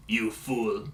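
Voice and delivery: low, quiet, evil intimidating villain voice; Doctor Eggman. Retold or imitated. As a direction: drop low and soft with menace. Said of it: "this evil, intimidating voice like".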